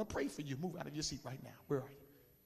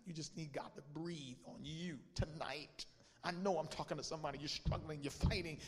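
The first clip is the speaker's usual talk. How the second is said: usual speaking voice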